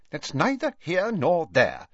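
icy official rebuff; cold. A narrator's reading. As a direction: shut the question down coolly. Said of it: "said Ogden coldly".

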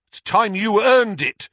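high, loud comeback; angry retort. Acted. Harry answers the Minister's demand for respect, defiant. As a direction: throw it back forcefully, leaning on earned.

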